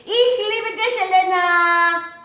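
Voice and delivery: a hint of the German mother; imitating her mother. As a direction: echo the mother's tender farewell. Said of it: "She would always say to me".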